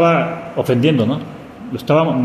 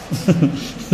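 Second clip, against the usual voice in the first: breathy chuckle; 4 dB quieter than usual. Real, not acted. Laughs at own joke.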